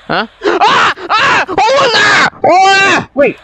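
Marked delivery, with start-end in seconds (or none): 0.4-1.4 high, loud screams of fright; startled high shrieks; jumpscare screams. Acted. Jumpscare in his game. 1.5-3.1 high-pitched cry of alarm; panicked shout. Acted. Jumpscare in his game.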